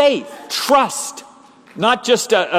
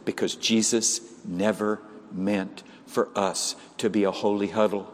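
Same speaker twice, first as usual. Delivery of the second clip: normal talking voice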